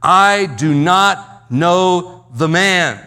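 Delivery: slow, loud outburst of temper; loud, angry denial. Retold or imitated. Peter in a fit of temper denying the man, loud and angry. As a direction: hurl each word out loudly, drawn out. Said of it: "in a fit of temper, he says loudly".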